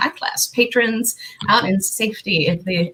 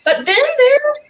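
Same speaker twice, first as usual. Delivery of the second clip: dramatic build-up; 5 dB louder than usual. Acted.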